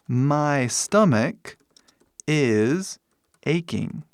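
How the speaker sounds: word-by-word with long pauses; slow model sentence. Shown as a demonstration. Teacher models the verb form for students, slowly. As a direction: separate the words for learners.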